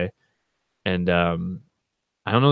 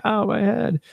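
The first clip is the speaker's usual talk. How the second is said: mock scream; 14 semitones higher, slower than usual. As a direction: wail it in mock pain. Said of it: "I'm just, like, screaming".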